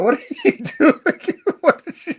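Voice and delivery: high, laughing disbelief; giggling at a strange picture sent in chat. Real, not acted. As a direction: laugh through the question.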